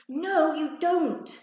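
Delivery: slow, very loud, high retort; angry denial. A narrator's reading. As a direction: shout her down in the quarrel. Schoolyard quarrel with Charlotte.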